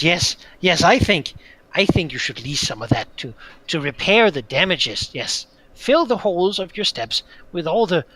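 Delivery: loud wheedling little creature; scheming creature voice. Acted. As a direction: coax them out of their gold. Creature demands payment for damages.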